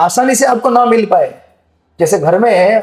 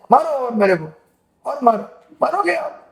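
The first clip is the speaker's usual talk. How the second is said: put-on high, soft, whiny child voice daring to be hit; a sulking kid whimpering back at a parent; crying child; 5 semitones higher, 5 dB quieter than usual. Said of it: "जैसे बच्चे होते हैं ना, बोलते हैं". (रोते हुए बच्चे की ऊँची, धीमी आवाज़)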